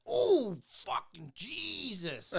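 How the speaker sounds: high shocked yelp, then a slow curse. Retold or imitated. Retelling how a coworker reacted when he saw the huge spider, with a high shocked yelp. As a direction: jump out of your skin at the spider.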